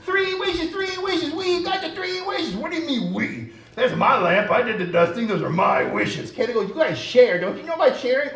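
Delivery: very high squeaky puppet shouting about wishes; high excited puppet bickering; excited puppet voices. Acted. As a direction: squabble greedily over the wishes. Puppets fight over wishes.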